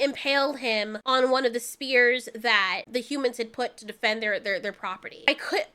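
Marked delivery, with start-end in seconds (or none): 3.8-5.7 much softer, sighing, words breaking off; real grief, barely able to finish a sentence; choked up. Real, not acted. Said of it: "This was so depressing".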